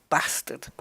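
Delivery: "bastard" with a short a, as in "cat"; short-vowelled English "bastard"; proper English pronunciation. Shown as a demonstration. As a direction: say "bastard" with the a of "hat". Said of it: "That's proper English".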